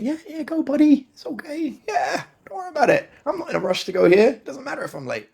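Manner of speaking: high airy mock-calm 'go, buddy'; easygoing driver voice. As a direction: play the overly relaxed driver waving everyone past, high. Mimics his relaxed self driving.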